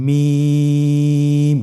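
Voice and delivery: মিম stretched very long and held with lips closed; drawn-out letter name. Shown as a demonstration. Teacher modelling recitation for students, long drawn-out মিম. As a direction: hold মিম for three counts, thin and loud. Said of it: "আবার বলুন".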